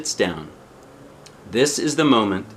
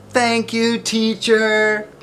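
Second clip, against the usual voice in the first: high, louder call of thanks; children calling out; 11 semitones higher, 4 dB louder, much slower than usual. A narrator's reading. Children calling thanks to their teacher, high and loud. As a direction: call it out brightly across the yard. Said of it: "call out".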